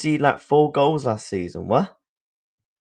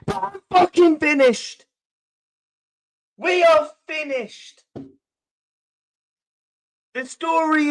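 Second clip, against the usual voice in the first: much higher, strained and anguished; seething anguish; 13 semitones higher than usual. Real, not acted. Streamer raging to chat about his team's collapse, high strained anguish. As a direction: let the fury crack high in the voice. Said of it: "I'm absolutely seething".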